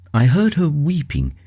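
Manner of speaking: ordinary delivery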